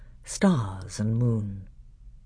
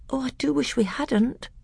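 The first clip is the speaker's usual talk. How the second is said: put-on higher, eager boy's voice; a young prince speaking eagerly; 11 semitones higher than usual. A narrator's reading.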